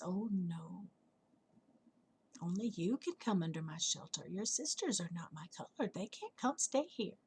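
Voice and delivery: softly voiced reluctant flower; soft refusal. Hibiscus turning away butterfly's sisters, quiet refusal. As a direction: turn them away quietly, with pauses.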